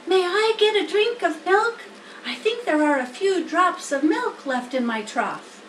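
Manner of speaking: a worried piglet begging for hope; young pig Wilbur. Acted. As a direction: Wilbur asks the spider to save him. Wilbur voiced by reader.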